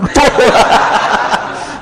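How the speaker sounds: high, airy burst of laughter; hearty laugh. Real, not acted. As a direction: laugh out at the joke. Laughs at ugly-wife joke. (ngguyu ngakak)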